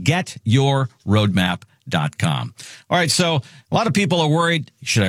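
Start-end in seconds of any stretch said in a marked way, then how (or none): 0.0-2.5 very slow, deliberate URL; slow and clear. Shown as a demonstration. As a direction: let each word land for the listener. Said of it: "Get your roadmap dot com is the website".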